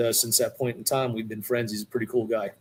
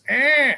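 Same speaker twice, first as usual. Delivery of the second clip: high, sharp cat yowl; a strained cat mewl, loud and high; imitated cat cry; 13 semitones higher, 7 dB louder than usual. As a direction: squeal it like a cat in distress. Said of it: "like a cat in distress".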